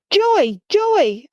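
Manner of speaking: panicked shouting of a name. A narrator's reading. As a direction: call out for a lost brother. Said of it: "I shout all around".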